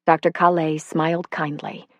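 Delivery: usual speaking voice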